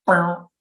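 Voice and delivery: a short plucked "Bow" imitating a green frog; banjo twang frog call. Shown as a demonstration. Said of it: "sounds like a banjo string twang".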